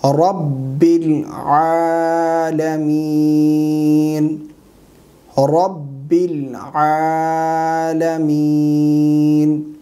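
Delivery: very slow Quranic recitation with long-held vowels; correct recitation. Shown as a demonstration. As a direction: recite it syllable by syllable, drawing out every long vowel. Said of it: "صحیح تلفظات کے ساتھ پڑھ کر سکھائیں گے".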